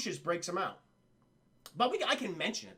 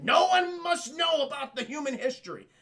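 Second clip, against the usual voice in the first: loud, raised decree; mock stern shout; 7 semitones higher, 6 dB louder than usual. Elder ape forbidding human history, hammed-up stern shout. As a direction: proclaim it like a fanatic elder.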